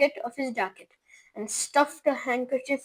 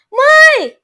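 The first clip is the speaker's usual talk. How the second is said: high, loud alarmed cry; a mother's startled shout; 13 semitones higher, 16 dB louder than usual. A narrator's reading. Mother cries out at her shrunken son. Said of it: "cried Missus T V".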